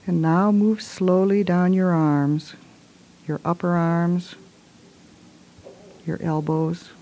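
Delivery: ordinary talk, nothing marked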